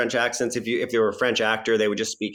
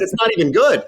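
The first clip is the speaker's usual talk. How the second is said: high, loud imitated British accent; mock-British exasperation at himself; put-on British voice, pitched right up; [name]; 12 semitones higher, 6 dB louder than usual. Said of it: "Tom was doing a British accent".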